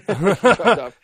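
high, loud laughing echo. Real, not acted. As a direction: laugh the words out. Laughing at the dropout.

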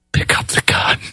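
menacing whispered threat; Jack Palance whisper. Retold or imitated. Gunslinger to sheep herder, a whispered menacing threat. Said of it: "You're like Jack Palance in the movie Shane".

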